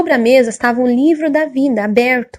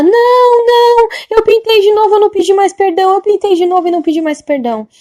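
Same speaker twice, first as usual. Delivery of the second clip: put-on high, louder old woman's plea, easing back down at the end; pleading old lady; 9 semitones higher, 4 dB louder than usual. Old woman admitting she never asked forgiveness again, high pleading then fading. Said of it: "E ela".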